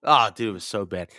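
normal talking voice

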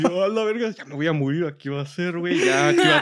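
high-pitched mock panic, breaking into laughter; retold panic. Friend retelling choking on his vomit, in laughing mock panic. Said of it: "Y yo así".

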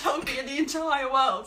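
usual speaking voice

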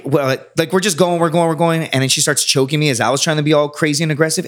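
usual speaking voice

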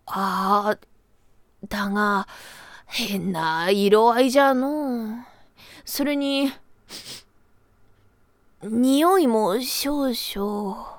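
a wary grandpa sniffing the cup; creaky wise old man. Acted. Old grandpa doubtful about brew.

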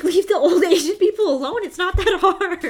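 loud, high exasperated scolding; angry outburst. Retold or imitated. Telling attackers off, loud and high with anger. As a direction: shout it like you're fed up with them. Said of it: "that really pissed me off. I was like".

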